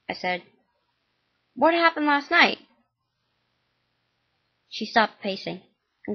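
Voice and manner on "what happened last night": higher, louder alarmed question. Acted. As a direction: blurt it out in alarm. Alarmed son asks mom.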